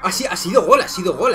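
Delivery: ordinary delivery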